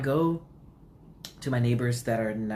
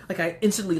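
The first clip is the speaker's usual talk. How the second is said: ordinary delivery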